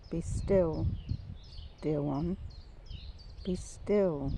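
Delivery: soft, unhurried "be still"; voice of God. Retold or imitated. God reassuring broken ones, soft and gentle. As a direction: say it gently, with pauses between phrases. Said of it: "But he says".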